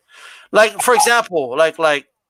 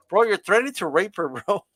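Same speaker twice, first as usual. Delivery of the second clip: ordinary talk, nothing marked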